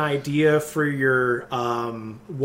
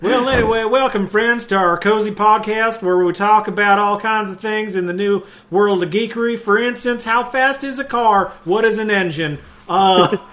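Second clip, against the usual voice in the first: higher, hammed-up Southern nascar host; Southern accent; 5 semitones higher, 5 dB louder than usual. Host opens the pretend nascar podcast, put-on Southern accent. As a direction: play a Southern podcast host welcoming friends. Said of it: "we're a Southern nascar podcast".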